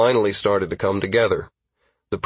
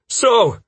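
relieved puff; 14 semitones higher, 6 dB louder than usual. Acted. Relieved exhale after running.

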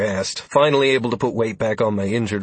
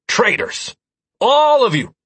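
high, loud mock betrayal; mock outrage; 6 dB louder, slower than usual. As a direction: cry traitor with a grimace. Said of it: "I announced with a grimace".

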